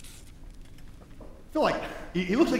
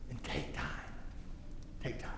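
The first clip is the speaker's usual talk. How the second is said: hushed repeat; 5 semitones lower, 16 dB quieter than usual. Retold or imitated. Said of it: "He says".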